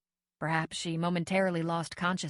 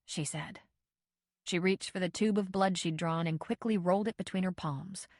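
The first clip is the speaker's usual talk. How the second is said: plain reading voice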